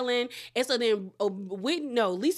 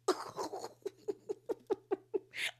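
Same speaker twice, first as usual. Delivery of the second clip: long breathy cackle trailing out; real laugh running out of air; helpless voiceless wheeze; wheezing laugh; 9 semitones higher, 11 dB quieter than usual. Real, not acted. Laughing at retold insult.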